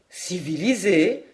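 slow, offended echo of the word "Civilisée", rising at the end; indignant. Acted. René echoing his mother's word, indignant. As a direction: throw "Civilisée" back at her, outraged and drawn out. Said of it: "interrompit René indigné".

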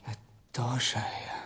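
slow hushed whisper; quiet conspiratorial whisper. Acted. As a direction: breathe it as a grim realisation. Secret discovery in mine.

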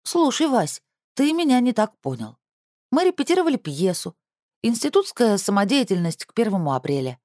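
overacted earnest young woman; mock serious. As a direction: put on a hammy solemn tone. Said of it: "очень серьёзный голос сестры".

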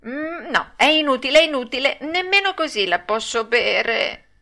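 high, fussy puppet voice; whining refusal. Acted. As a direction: refuse like a stubborn child. Pinocchio refuses again.

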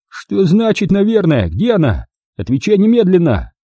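high, sharp questioning; demanding interrogation. A narrator's reading. Captor demands where the girl is, angry. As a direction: press him hard for an answer.

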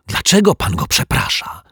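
put-on high, indignant girl's question; outraged girl questioning the detective on the stairs. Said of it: "oburzona Zuzia".

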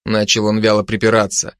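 plain reading voice

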